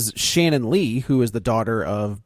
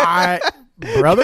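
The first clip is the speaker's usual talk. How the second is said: incredulous; 5 semitones higher, 5 dB louder, slower than usual. Real, not acted. Incredulous reaction.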